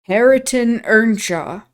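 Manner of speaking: slow, syllable by syllable spelling out; halting, drawn-out reading of a name; drawled by syllables. Acted. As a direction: sound it out slowly like a new reader. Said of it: "He spelt and drawled o-, over by syllables".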